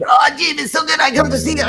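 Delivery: a hammed-up Italian tourist gushing, high; hammed-up Italian accent, shouted praise; bad Italian accent. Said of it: "that's not even an Italian accent".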